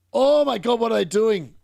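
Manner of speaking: loud, high gasp of disbelief; can't believe the blunder; stunned and loud; shocked outcry. Real, not acted. Sudden defensive mistake.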